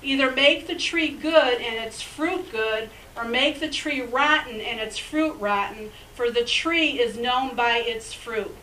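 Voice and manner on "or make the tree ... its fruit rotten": normal talking voice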